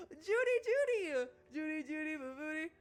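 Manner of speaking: excited high voice. Acted. Reunited with her friend Judy, high thrilled gushing. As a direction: bubble over like a reunited best friend. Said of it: "My voice raised in pitch and speed".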